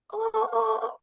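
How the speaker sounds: mock moans. Mocking overacting performers with high moans. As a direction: ham up fake moans.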